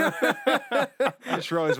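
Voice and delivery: high, loud and slowed by laughter; laughing. Real, not acted. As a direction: squeeze the words out while cracking up. Cracks up at friend's remark.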